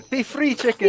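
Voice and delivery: mock farewell. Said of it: "She literally just said it".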